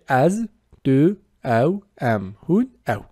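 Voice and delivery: teacher modelling each Kurdish pronoun: از، دو، او; slow pronoun demo. Shown as a demonstration. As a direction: say از، دو، او، ام، هون، او one by one, slow and distinct.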